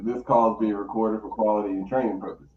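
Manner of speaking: recorded announcement. Retold or imitated. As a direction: rattle it off like a recording. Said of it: "I hit 'em with the".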